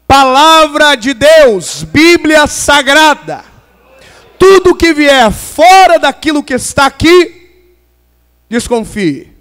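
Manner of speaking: loud, slow, weighty pulpit delivery; emphatic preaching proclamation. Real, not acted. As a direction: declare it forcefully, stretching the key words. Preacher proclaims the Bible as the message.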